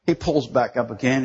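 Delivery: normal talking voice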